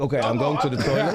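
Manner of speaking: said through a laugh; giggling while quoting. Retold or imitated. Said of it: "I was like".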